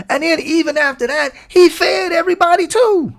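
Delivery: climbing to a high, delighted exclamation; excited preaching peak. Acted. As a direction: hit the top of your voice with joy.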